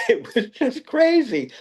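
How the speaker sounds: high, laughing aside. Real, not acted. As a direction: let it out through a laugh. Laughing at the memory.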